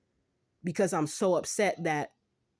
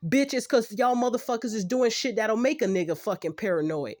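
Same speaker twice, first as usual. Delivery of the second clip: higher, louder indignant rant; heated, exasperated clapback; real angry outburst; 4 semitones higher, 5 dB louder than usual. Real, not acted. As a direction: lash out at the doubters. Angry rant at her doubters.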